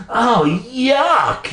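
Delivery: high-pitched wail of disgust; acted high, disgusted whine. A narrator's reading. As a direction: wail it, utterly grossed out. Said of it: "she wailed".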